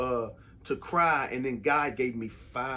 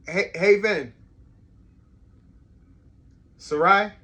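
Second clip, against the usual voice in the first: calling out; 6 semitones higher, 12 dB louder than usual. Real, not acted. Dad calling his daughters from downstairs, real loud calling out. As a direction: holler names so they hear upstairs.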